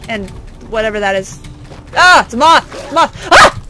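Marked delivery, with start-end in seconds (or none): 2.0-3.6 high, loud alarm at a moth; jumpy raised-voice cries; startled yelping. Real, not acted. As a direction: yelp in sudden fright.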